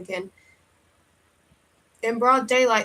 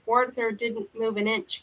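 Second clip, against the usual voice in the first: plain reading voice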